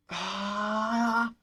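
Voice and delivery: drawn-out "aah" cry; one long, held groan of frustration; long frustrated wail. Real, not acted. Gamer after a bad moment in the match, long frustrated wail.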